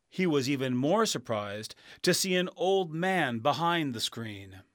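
ordinary delivery, nothing marked

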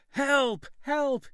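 high, loud cries for help; shouting for help. A narrator's reading. Boy running to the village, shouting for help. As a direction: yell it down the mountain. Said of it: "he shouted".

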